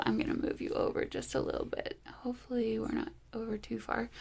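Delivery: quick whispered aside; whispering. Real, not acted. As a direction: whisper it quickly under your breath. Moving the camera, aside.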